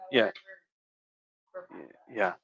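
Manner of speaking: ordinary talk, nothing marked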